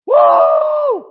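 high whooping shout; 1 joyful whoop. Real, not acted. Preacher whooping to stir the church, high shout.

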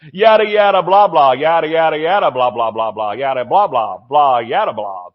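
loud start sinking into low drone; run-on nonsense, dropping in pitch; mocking babble. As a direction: mimic endless pointless chatter. Mocking people's negative chatter.

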